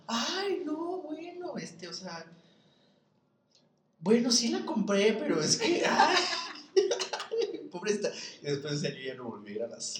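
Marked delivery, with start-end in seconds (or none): 0.1-8.1 high, laughing confession in the mom's voice; squeaky caught-out voice that giggles through it; flustered mother. Retold or imitated. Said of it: "Y mi mamá así como de". (voz muy aguda de mamá apenada, entre risas)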